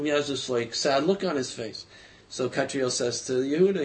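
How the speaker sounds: usual speaking voice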